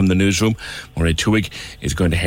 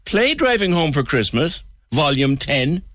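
mock command; 9 semitones higher than usual. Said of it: "Will I do it again?".

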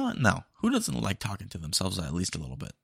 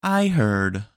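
loud, flat machine confirmation; louder drive-thru bot voice; robot voice; 3 semitones higher, 6 dB louder, much slower than usual. Acted. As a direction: mishear like a dumb bot. AI bot mishears.

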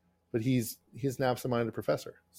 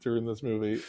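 ordinary delivery

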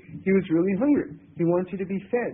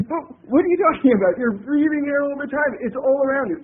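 a louder, higher, incredulous reply; hammed-up disbelief at the complaint; baffled retort; 6 semitones higher, 6 dB louder than usual.

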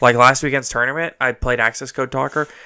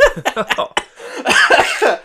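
high trailing laughter; 12 semitones higher, 4 dB louder than usual. Real, not acted. Still laughing.

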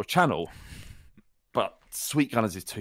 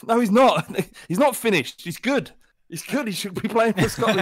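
high, loud mock-indignant caller; insistent protest; 12 semitones higher, 7 dB louder than usual. Mistaken Rangers fan to radio host, insistent protest. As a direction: argue back high and adamant.

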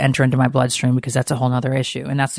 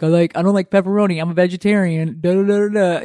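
slightly higher, mildly goofy retelling; kinda goofy; 4 semitones higher, faster than usual. Retold or imitated. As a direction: rattle it off a bit goofily. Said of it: "it was kinda goofy".

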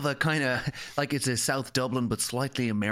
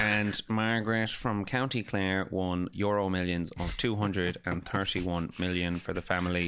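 low, slow monotone news read; same-tone newsreader; 8 semitones lower than usual. Retold or imitated. Radio newsreader reading lottery win, unvaryingly solemn. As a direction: parody a newsreader who never varies tone. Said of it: "they have the same tone no matter what".

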